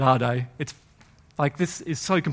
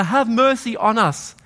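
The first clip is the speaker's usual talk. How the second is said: slow, raised plea; pleading call; 6 semitones higher, slower than usual. Acted. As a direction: stretch out the plea for mercy. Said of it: "even louder".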